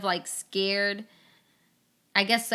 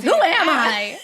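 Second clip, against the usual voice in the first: higher, louder, laughing self-question; laughing disbelief; 6 semitones higher, 10 dB louder, slower than usual. Retold or imitated. Host to guest, laughing about her phone self. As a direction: laugh it out incredulously. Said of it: "I'm like".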